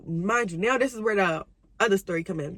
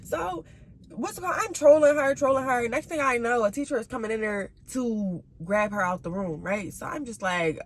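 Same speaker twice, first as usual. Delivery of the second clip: ordinary delivery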